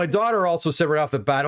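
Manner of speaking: ordinary talk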